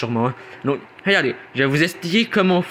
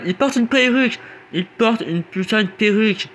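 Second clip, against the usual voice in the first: high outraged squeal; 8 semitones higher than usual. Acted. Mocking outrage at wig.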